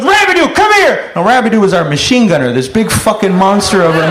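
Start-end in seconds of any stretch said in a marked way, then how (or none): 0.0-1.1 high, hollered summons; sergeant calling out. Acted. Sergeant summoning his big machine gunner, high holler. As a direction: holler for a soldier to come over. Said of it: "He goes".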